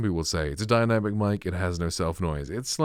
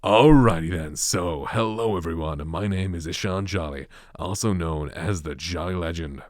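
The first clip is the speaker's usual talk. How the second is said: bright American accent, opening with a loud burst; 4 dB louder than usual. Shown as a demonstration. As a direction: introduce yourself as an upbeat American host. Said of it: "do a quick demonstration of my American accent".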